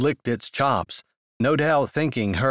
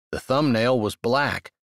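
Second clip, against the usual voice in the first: ordinary delivery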